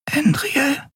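high, breathy sleepy whisper. Acted. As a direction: whisper her name half asleep. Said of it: "Nelens schläfrige Stimme".

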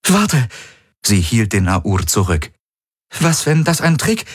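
sudden high-pitched halt; wary warning. A narrator's reading. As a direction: stop them, pitched high and urgent. Wary, holds others back.

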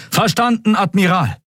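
high-pitched acknowledgement to the admiral; soldiers' high answering call; troops echoing. A narrator's reading. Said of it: "echote ein Großteil seiner Leute".

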